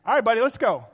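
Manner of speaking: rally the horse on, hammed up; urging horse. Rider urges the stubborn horse on, mock-loud.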